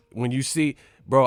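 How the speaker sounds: usual speaking voice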